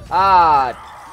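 loud, high yell of frustration; frustrated yell. Real, not acted. Player fails to escape, loud frustrated yell. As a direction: cry out at losing.

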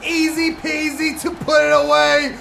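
very high, loud yell easing into excited shouting; ecstatic goal scream. Real, not acted. Gamer celebrating an easy goal, ecstatic shouting.

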